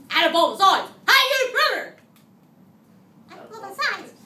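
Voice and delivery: high, loud sing-song call; weird voice. Shown as a demonstration. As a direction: shout the question back at them. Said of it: "We say it in a weird voice".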